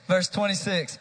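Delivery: normal talking voice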